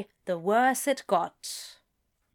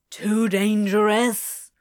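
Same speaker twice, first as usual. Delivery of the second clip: slow, louder disbelieving echo; near shout, incredulous; 5 dB louder, slower than usual. A narrator's reading. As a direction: throw it back in disbelief. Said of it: "Crowley nearly shouted".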